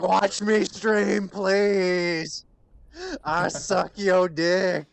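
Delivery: high, slow, whiny pleading; begging streamer voice. Retold or imitated. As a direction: beg shamelessly for viewers. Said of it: "I just wanna be like".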